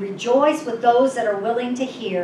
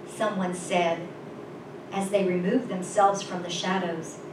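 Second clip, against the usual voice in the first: usual speaking voice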